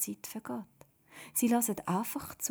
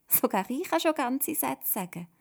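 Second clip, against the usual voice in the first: put-on proud child, higher and louder; 6 semitones higher, 5 dB louder than usual. A narrator's reading. Simon showing off his full sentences, proudly. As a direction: boast like a small boy showing off. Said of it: "sagt er stolz".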